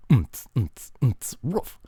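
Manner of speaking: single short beatbox phrase; brief mouth-percussion bar. Shown as a demonstration. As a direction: thump it once and finish on a woof. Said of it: "only doing it once".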